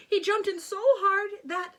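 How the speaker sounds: ordinary delivery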